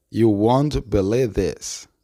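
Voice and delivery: slow, word-by-word "You won't believe this"; slowed-down model. Shown as a demonstration. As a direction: draw out each word for learners. Teacher slows the model sentence. (frase modelo dicha despacio)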